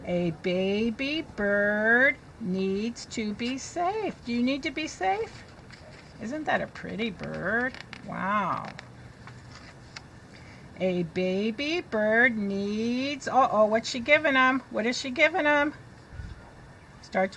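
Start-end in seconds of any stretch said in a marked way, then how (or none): 0.1-4.1 slow read with a lift on safe; teacher reading to children; slow reading aloud. Shown as a demonstration. 10.8-13.3 very slow, careful read-aloud; teacher reading to children. Shown as a demonstration.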